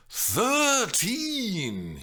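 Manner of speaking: high, long, shocked objection; outraged at the age; appalled. Real, not acted. Mimi appalled that Juliet is thirteen, high and shocked. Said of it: "she objected, appalled".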